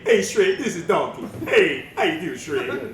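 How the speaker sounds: chatty Donkey greeting his friend; Donkey impression. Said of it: "I can sound like Donkey".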